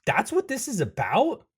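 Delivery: high, incredulous protest building to a shout; exasperated outrage. Acted. Anderson contesting the frog's accusation, outraged and loud. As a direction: explode in disbelief.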